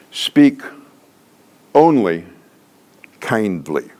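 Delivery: "Speak", "only", "kindly" each set apart by a long pause; emphatic statement. Shown as a demonstration. Trainer stating a pillar to trainees, "Speak only kindly" in emphatic separate words. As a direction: land "Speak only kindly" word by word, falling on "kindly". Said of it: "emphatic, em-, uh, statements".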